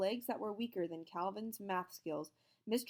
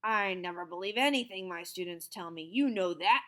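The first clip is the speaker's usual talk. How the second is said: loud sneering dismissal; 7 dB louder than usual. A narrator's reading. Old teacher sneering at the excuse, loud. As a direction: sneer the words.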